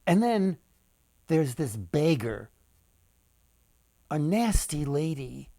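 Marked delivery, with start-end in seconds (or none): none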